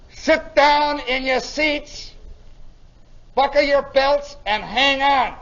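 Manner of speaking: slow, loud, deliberate command; lieutenant giving orders. Retold or imitated. Lieutenant bracing his crew for landing, slow loud orders. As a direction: order them firmly, word by word. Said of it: "And the lieutenant says".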